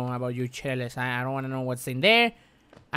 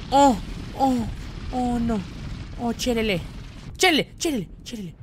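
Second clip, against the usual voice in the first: high cries easing off in volume; alarmed dismay; 7 semitones higher than usual. Real, not acted. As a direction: keep wailing in alarm as the scare subsides. Aftermath of game attack.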